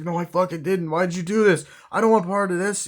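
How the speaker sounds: imitating a distressed accomplice; acted panicked protest. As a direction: protest in rising alarm. Acts out panicked denial and protest.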